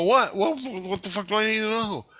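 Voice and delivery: incredulous high-pitched retort; baffled outburst. Retold or imitated. Retold baffled outburst at Jesus.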